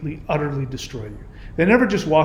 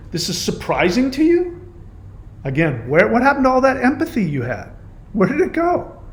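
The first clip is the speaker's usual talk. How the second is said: incredulous; 7 semitones higher than usual. Real, not acted. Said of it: "incredulous at commenter".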